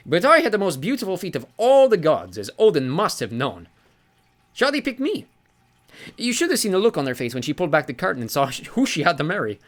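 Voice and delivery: beaming sea god. Acted. Sea god basks in praise of his feet, beaming. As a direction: preen over the compliment. Njord voiced in story.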